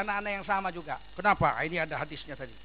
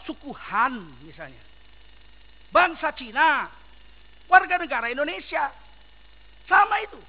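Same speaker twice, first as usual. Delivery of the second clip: loud, emphatic sermon delivery; fiery preacher hammering each point; forceful preaching; 5 semitones higher, 9 dB louder than usual. Acted. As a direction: preach it hard to the crowd.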